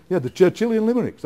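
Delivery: mock correct take with "limericks" said fully and loud; got it right. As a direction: land "Churchillian limericks" triumphantly, every syllable clean. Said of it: "We'd have probably to do a few takes".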